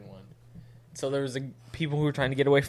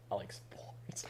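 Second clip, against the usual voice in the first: softer, whispered mock kid; whispering; 7 semitones lower, 17 dB quieter, slower than usual. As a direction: whisper it shyly. Mocking awkward child actor.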